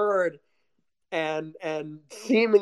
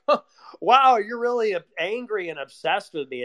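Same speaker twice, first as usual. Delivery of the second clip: mocking imitation of an offended culprit; mock astonishment; 5 semitones higher than usual. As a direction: act out a dismissive, fake-amazed retort. Imagined offender's dismissive retort.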